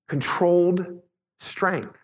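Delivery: controlled strength said slowly, controlled stressed; slow emphatic definition. Shown as a demonstration. Preacher defining meekness for the congregation, slow and emphatic. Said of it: "Meekness says".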